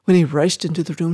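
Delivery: usual speaking voice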